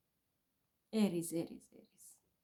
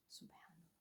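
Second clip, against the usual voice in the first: whispered Subhanallah; 5 semitones lower, 32 dB quieter than usual. Real, not acted. Whispered exclamation of dismay.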